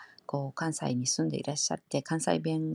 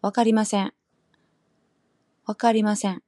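와카리마센 higher and louder, accent on 카; Kansai accent; 5 semitones higher, 7 dB louder than usual. Shown as a demonstration. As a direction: punch 와카리마센 up high in Kansai style. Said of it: "칸사이 쪽은".

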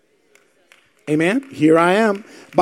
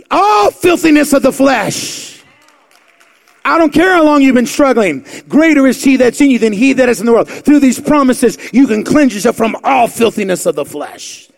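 louder, higher, fervent sermon climax; full-force preaching; 6 semitones higher, 5 dB louder than usual. Acted. Pastor thundering scripture at his church, full-force preaching. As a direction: preach it out hard over the congregation.

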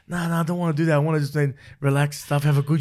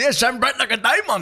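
mimicking reply; 7 semitones higher than usual. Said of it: "He goes".

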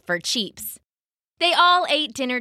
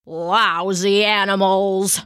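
put-on low, slow old Papa grumble; grumbling; 4 semitones lower, slower than usual. A narrator's reading. Papa at the table, sore at his animals, grumbling. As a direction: grumble it slowly, pitched down. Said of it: "he grumbled again".

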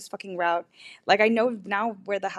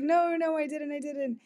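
higher-pitched reassuring denial; hasty denial; 6 semitones higher than usual. Retold or imitated. John denying it to his mortified friend, hasty denial. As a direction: imitate a friend quickly backtracking.

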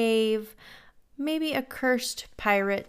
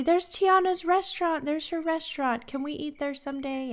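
high, eager child voice, lightly imitated; excited little girl; 7 semitones higher than usual. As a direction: mimic a little kid begging. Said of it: "they would say".

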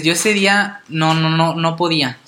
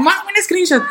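high, eager and pushy; excited dad; 12 semitones higher than usual. Retold or imitated. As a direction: urge it like a thrilled father. Excited dad asking for photos.

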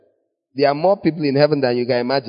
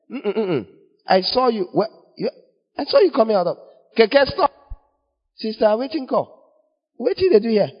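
lightly voiced busybody, pitched up; higher-pitched mock scolding of someone seen out; busybody church member; 6 semitones higher than usual. Church member confronting someone spotted coming out, mock accusing.

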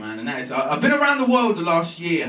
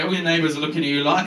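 normal talking voice

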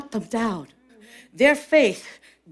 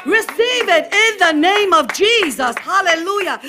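full-voiced sermon proclamation, higher pitch; preach; 9 semitones higher, 4 dB louder than usual. Real, not acted. Preacher urging the congregation to receive it, preaching at full force. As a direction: call out the promises with force.

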